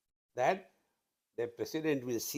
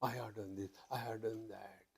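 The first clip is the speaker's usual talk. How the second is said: soft, sulky self-pity, much softer; mock the hurt ego quietly; a hushed, wounded I-did-so-much; self-pitying whine; 5 semitones lower, 14 dB quieter than usual. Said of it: "we become emotional".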